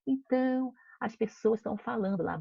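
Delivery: put-on lively read-aloud for children; story narration. A narrator's reading. Teacher reading a picture book to her class, lively storytelling. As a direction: tell the tale to the kids.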